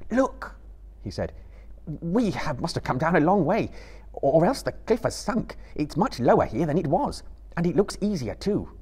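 put-on a young hobbit peering over the edge; Frodo. A narrator's reading. Frodo speaks at the cliff edge.

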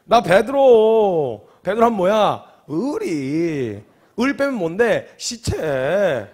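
loud, very slow boast; boastful drawl. Retold or imitated. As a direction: drag out each word like a proud tough guy. Peter boasting of his loyalty.

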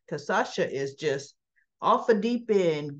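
ordinary delivery

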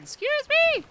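very high, loud squawk; incredulous high-pitched question; indignant disbelief. Real, not acted. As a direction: squeal it in outrage at the game. Indignant at the game.

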